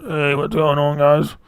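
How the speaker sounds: worn-out voice put on for a greeting; Keith Peterson. Streamer mocking his overused voice to viewers, as an impression. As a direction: greet them in an overworked, altered voice. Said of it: "I sound like fucking Keith Peterson".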